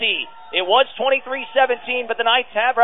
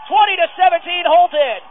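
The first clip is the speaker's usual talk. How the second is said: excited score; 6 semitones higher than usual. Real, not acted. Announcer shouting the score to the crowd, excited. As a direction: ring out the score like it matters. Score after big point.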